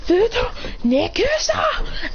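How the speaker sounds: high, drawn-out battle cry; shouted attack call. Acted. Fighter blasting Indra from above, high shouted attack name. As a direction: shout the move's name, stretched out.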